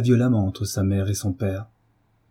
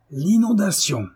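higher, scornful mock exclamation; sardonic outburst; 10 semitones higher than usual. A narrator's reading. Husband scoffs at wife's excuse, sardonic burst. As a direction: spit the word back with scorn. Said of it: "avec un éclat de rire sardonique".

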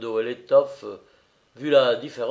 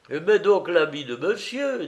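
кухарка подзадоривает; 6 semitones higher than usual. A narrator's reading. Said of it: "dit la cuisinière".

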